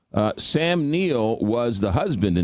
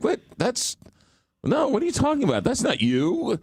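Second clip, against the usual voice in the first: much higher, baffled protest in mock disbelief; incredulous disbelief; 14 semitones higher than usual. Listener hearing an actor's real accent, very high incredulous. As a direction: squeak out an outraged double take. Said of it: "you're like".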